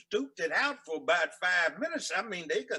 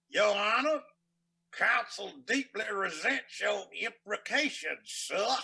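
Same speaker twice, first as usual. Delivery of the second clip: loud, slow, drawn-out mock-formal indignation; nasty courtroom sparring; 6 semitones higher, 4 dB louder, slower than usual. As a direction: orate it higher and slower, like feuding judge and lawyer. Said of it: "the judge and the lawyer were getting nasty".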